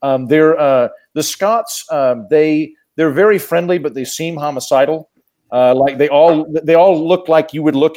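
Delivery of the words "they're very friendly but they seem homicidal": ordinary delivery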